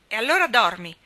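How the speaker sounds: put-on loud, cross little girl snapping; sharp and loud, fed up with her brother; irritated snap. A narrator's reading. Nan, tired and cross, snaps at Rob to sleep. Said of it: "disse Nan stizzita".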